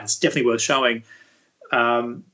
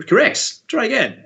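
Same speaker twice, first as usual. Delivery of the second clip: a higher, cheery mock-teacher verdict; 10 semitones higher, 3 dB louder than usual. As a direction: play the teacher sending them back to work, brightly. Teachers answering student in anecdote.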